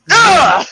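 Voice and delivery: high, loud pained grunt; pained groan. Retold or imitated. Reliving the bite to his face, high pained grunt. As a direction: grunt as if just hit.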